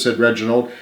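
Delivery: usual speaking voice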